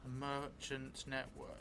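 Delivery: slow, drawn-out in-character telling, often hushed; slow story narration. Acted. Vampire player dictating a new memory to the audience, slow hushed narration. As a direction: spin the vampire's memory word by word.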